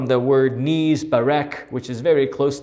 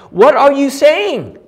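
much higher and louder, exasperated; upset outburst; 12 semitones higher, 9 dB louder than usual. Acted. Jacob upset at Rachel's words, exasperated outburst. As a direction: throw up your hands in disbelief. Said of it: "He was upset".

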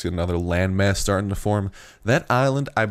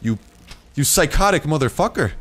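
loud, raised-pitch exasperated shouting; angry yelling; 9 semitones higher, 4 dB louder than usual. Real, not acted. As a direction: yell at it while fighting back. Fighting the attacking creature.

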